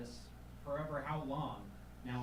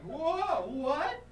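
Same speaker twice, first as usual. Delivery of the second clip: high, loud startled whoa; shocked disbelief; 13 semitones higher, 11 dB louder than usual. Retold or imitated. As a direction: jolt back in disbelief. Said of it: "I was like".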